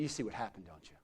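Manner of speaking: ordinary talk, nothing marked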